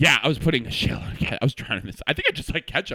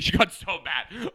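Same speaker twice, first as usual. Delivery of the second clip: normal talking voice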